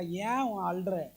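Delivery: imitating a falsely tender question; husband faking soft concern for his crying wife; mock gentle husband. Husband asks softly why she cries.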